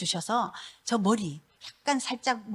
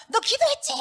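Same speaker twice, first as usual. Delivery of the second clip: high, loud accusing question; mock the husband's startled accusation, pitched way up; 4 dB louder than usual. Husband at the door accusing his praying wife, high and loud.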